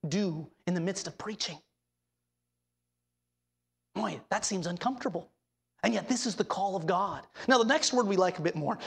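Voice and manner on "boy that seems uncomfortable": normal talking voice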